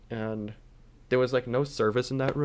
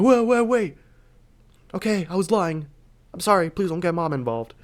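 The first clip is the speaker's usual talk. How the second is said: panicked begging; 6 semitones higher, 4 dB louder than usual. Retold or imitated. Kid caught lying, begging.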